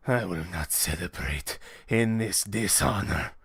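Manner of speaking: very low, soft character voice; deep and quiet, with wounded honor; grim refusal. Acted. Fighter refuses to celebrate to the mistress, deep and grim.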